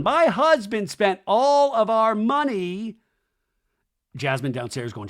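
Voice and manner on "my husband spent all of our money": high, slow whiny mimic; mock whiny wife; mocking whine. Mocking Michelle's complaint.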